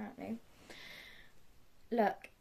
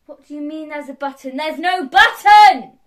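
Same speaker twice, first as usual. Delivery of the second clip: building from raised voice to full high shout; exasperated outburst; 5 semitones higher, 20 dB louder than usual. Acted. Villain arguing with mum about a button, exasperated shout. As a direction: lose it completely, stretching the final words.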